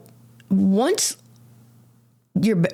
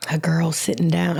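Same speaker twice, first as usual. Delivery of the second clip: normal talking voice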